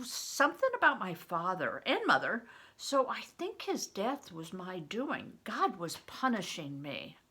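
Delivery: expressive read-aloud to the class; teacher reading a novel to students; story reading. A narrator's reading. Teacher reads chapter aloud.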